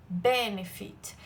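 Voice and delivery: benefit drawn out slowly, weight on the first syllable; stress on BE. Shown as a demonstration. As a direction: stretch benefit, leaning on "be" and letting "fit" fall away. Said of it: "A minha sílaba tônica é B". (benefit devagar, com força no "be" inicial)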